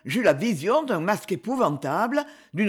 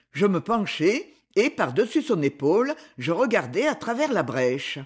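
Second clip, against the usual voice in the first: ordinary delivery, nothing marked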